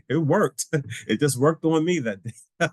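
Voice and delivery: high-pitched, laughing through the words; amused laughing. Real, not acted. Telling how his advice backfired on him, laughing. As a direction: crack up while delivering the punchline.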